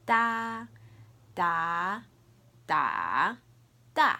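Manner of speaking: sing-out "Da" through the four Mandarin tones, drawn out, last one short; four tones demo. Shown as a demonstration. Teacher modelling for learners to repeat, four loud tones on "Da". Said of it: "I'll say it one more time".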